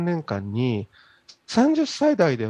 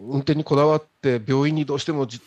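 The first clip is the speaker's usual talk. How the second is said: ordinary delivery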